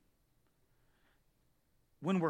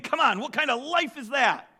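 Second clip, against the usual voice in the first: ordinary talk, nothing marked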